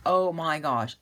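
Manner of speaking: normal talking voice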